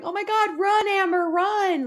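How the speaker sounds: very high, urgent pleading; mock panic. Viewer typing warnings to Amber, mock panic. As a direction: plead with someone on a screen to flee. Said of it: "I'll be sitting there typing".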